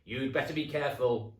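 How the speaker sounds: high, loud accusing hag voice; a loud, high witchy jab; sneering old wife. Acted. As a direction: needle him like a nasty old wife. Mrs Twit warning husband.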